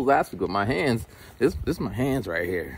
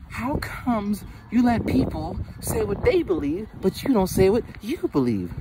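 her voice; 11 semitones higher than usual. Retold or imitated. Friend questioning him, lightly voiced high imitation. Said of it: "So she was saying".

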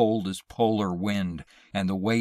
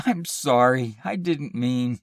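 higher, pleading apology; remorseful apology; 5 semitones higher, 5 dB louder than usual. A narrator's reading. Wife apologizing to sobbing husband, remorseful. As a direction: plead for forgiveness, full of regret. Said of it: "all remorse".

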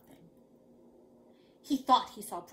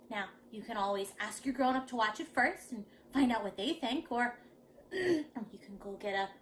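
ordinary delivery, nothing marked

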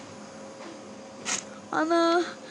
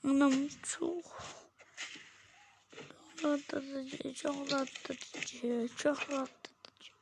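much softer, slow, fading into a whisper; drowsy murmur; 2 semitones lower, 9 dB quieter, slower than usual. Real, not acted. Sleepy vlogger softly murmuring to viewers. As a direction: sigh it out as if falling asleep.

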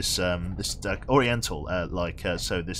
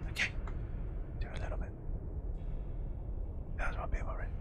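soft breathy whisper, half-joking; ASMR whisper; 8 semitones higher, 9 dB quieter than usual. Pretend. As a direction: whisper it like an ASMR clip, playfully. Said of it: "A S M R".

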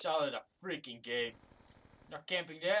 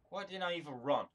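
ordinary delivery

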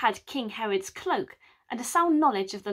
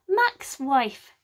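ordinary delivery

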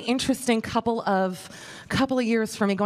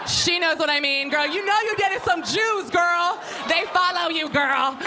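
sassy call-out; 7 semitones higher, slower than usual. Real, not acted. Teasing a woman in the crowd, sassy call-out.

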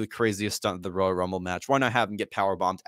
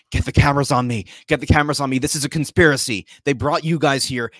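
frantic, louder panicked demands; freaking out; 4 semitones higher, 6 dB louder than usual. Retold or imitated. Zayn panicking at the other wrestlers, loud frantic yell. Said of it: "Zayn's freaking out".

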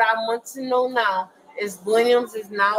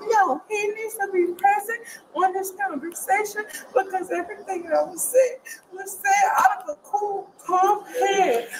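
crying; 6 semitones higher than usual. Real, not acted. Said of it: "She don't even know why she crying".

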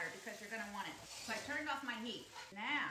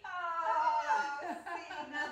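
laughing; 5 semitones higher, 12 dB louder, much slower than usual. Real, not acted. Friend arrives, laughing greeting.

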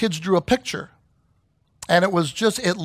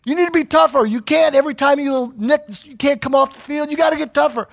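higher, louder tough-love coach barking; in-your-face coach; 5 semitones higher, 4 dB louder than usual. Retold or imitated. Coach confronting a player who keeps leaving the field, loud. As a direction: get in the player's face and push him. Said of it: "you get in their face".

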